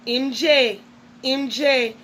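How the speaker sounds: usual speaking voice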